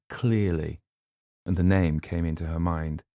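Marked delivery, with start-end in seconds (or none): none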